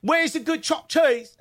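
high mock Bronx voice; New York accent. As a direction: ask it like a Bronx local.

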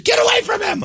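hammed-up furious mom yell; screaming mom. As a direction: shriek like a frantic mother.

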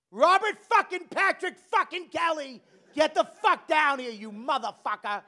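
high, loud screaming full name; furious mother hollering for her kid; mother's furious yell. Retold or imitated. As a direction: scream it like an enraged mom. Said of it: "she'd go".

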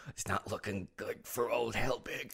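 lightly imitated losing-player grumble; slow, softened mimicry of a sore loser; mock Scrabble grumbling. Teasing co-host about losing at Scrabble, mock grumble. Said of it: "you get real mad when you're playing Scrabble".

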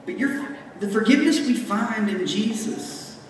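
normal talking voice

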